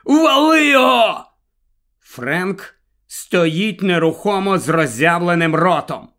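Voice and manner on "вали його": high, loud yelled command; grandpa's shout. A narrator's reading. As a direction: yell it urgently to an accomplice. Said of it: "кричить дідусь".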